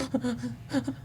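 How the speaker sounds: soft, high mock sob-gasps; quiet pretend catching breath after crying; imitated huffing and puffing. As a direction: act out leftover crying hiccups of breath. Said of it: "just huffing and puffing".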